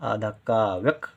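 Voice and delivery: व्यक pulled apart very slowly; slow syllable demo. Shown as a demonstration. Teacher isolating a half letter for pupils, slow. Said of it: "का बहुत कम देर के लिए बोला जा रहा है".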